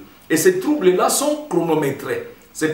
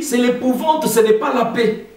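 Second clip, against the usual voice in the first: "l'épouvante" and "pas" pushed higher; stress on épouvante; 8 semitones higher than usual. Shown as a demonstration. Preacher repeating the warning to the congregation, higher. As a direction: raise the pitch on "c'est l'épouvante". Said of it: "j'attire encore".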